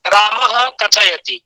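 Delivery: रामः said slowly with a full visarga, "रामःह" echoing from the throat; slow रामः कथयति with a clear ह after रामः; रामः with a full throat aspiration, then कथयति. Shown as a demonstration. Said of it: "आप जो सुन रहे हैं, विसर्ग सुन रहे हैं".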